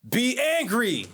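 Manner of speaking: high, slow, stretched-out angry shout. Shown as a demonstration. Teacher showing students how to sell anger. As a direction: sell the anger, drag it out big. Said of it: "if you're angry about something and you really want to sell it".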